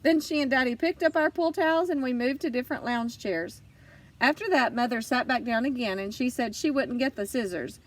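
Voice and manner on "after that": ordinary delivery, nothing marked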